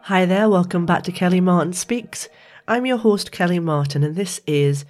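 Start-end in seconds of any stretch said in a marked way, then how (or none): none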